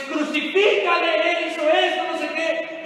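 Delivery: high, loud mob cry; a preacher voicing the mob's demand; crowd shouting. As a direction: yell it like an angry crowd. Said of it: "el pueblo dice".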